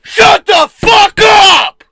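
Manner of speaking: very high, very loud, slow scream; full scream. Shown as a demonstration. Showing his friend how he yelled, full scream. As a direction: scream it at the top of your lungs. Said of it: "that was loud".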